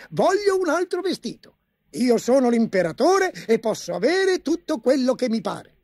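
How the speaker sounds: high petulant outburst; petulant emperor. A narrator's reading. Emperor brushing off the chancellor, high and petulant. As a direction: toss it off like a spoiled child.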